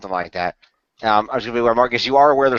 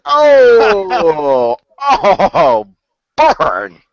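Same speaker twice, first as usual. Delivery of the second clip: louder and higher gleeful taunt; teasing burn; 7 semitones higher, 7 dB louder, much slower than usual. Real, not acted. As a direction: crow over the roast. Teasing a friend's roast.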